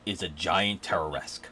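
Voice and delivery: usual speaking voice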